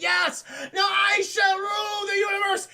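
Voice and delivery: high, loud hammed-up gloating; triumphant villain shout. [name] seizing power with the crystal, triumphant shout. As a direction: proclaim it at a sustained high yell.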